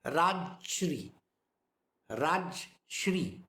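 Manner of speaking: "Rajshri" with Raj closed, no vowel before shri; wrong, two syllables. Shown as a demonstration. Teacher showing what people say wrongly, "Rajshri" in two syllables. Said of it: "Sometimes people say Rajshri".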